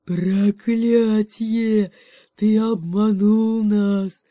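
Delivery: very slow, loud furious bellow; bear's roar. Acted. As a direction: roar it like a tricked bear. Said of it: "взревел медведь". (медленный громкий рёв медведя)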